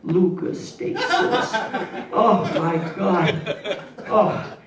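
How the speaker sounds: slow, drawn-out savoring. Acted. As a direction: stretch it out like a sensual moan. Savoring food description.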